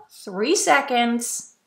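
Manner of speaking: ordinary talk, nothing marked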